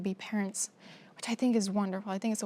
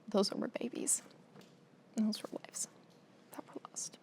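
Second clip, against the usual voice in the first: overcome with emotion; 9 dB quieter than usual. Real, not acted. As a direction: voice fading into a tearful whisper. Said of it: "I'm so emotional".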